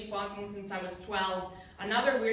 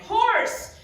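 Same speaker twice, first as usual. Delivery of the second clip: high, loud shocked shriek; horrified cry; 12 semitones higher, 9 dB louder than usual. Acted. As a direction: cry out in mock horror at the discovery. Acts man finding horse's head.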